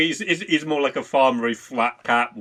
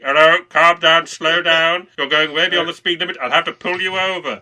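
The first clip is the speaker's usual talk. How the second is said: loud, higher hammed-up cop orders; mock traffic cop; 5 semitones higher, 7 dB louder than usual. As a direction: bark it like a cop through a horn. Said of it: "a guy with a trumpet going like".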